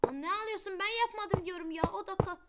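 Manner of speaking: high, loud teasing yell at a crybaby friend; mock-angry shout. Real, not acted. Yelling at a crying friend over the game, mock angry. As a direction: scold him loudly, half joking.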